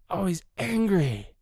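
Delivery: normal talking voice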